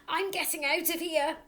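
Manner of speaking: high, loud fleeing voice; father bolting in fear; panicked exit. Acted.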